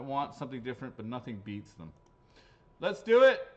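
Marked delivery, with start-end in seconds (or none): 2.8-3.5 loud, high shout to kick off; sudden hyped shout; rousing call. Real, not acted. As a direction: holler it to get things going. Calling out to start the draw.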